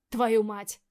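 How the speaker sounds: loud furious swearing; angry curse. A narrator's reading. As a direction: spit out the curse in rage. Swearing in anger.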